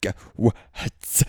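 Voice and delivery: imitating a panicked swimmer kicking and screaming; mock panicked screaming. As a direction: play it as a mock freakout, building as you go. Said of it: "screaming like".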